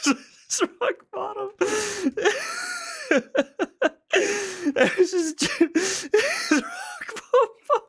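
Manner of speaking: laughing so hard it squeaks; crying with laughter, high and breathless; hysterical laughter. Real, not acted. Helpless laughing fit.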